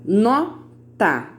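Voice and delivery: "Nota" split into no, ta with a pause; two separate beats on "Nota"; syllable by syllable. Shown as a demonstration. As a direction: leave a gap between the syllables of "Nota". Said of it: "duas sílabas".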